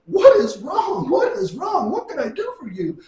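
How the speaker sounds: mock alarm. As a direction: imitate a friend pleading to know what's wrong. Friend frantically asking sobbing woman.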